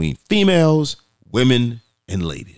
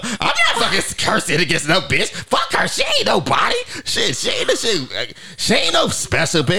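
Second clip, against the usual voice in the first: mock angry rant; 9 semitones higher than usual. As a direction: play her snapping back in outrage. Meghan voiced angrily cursing back.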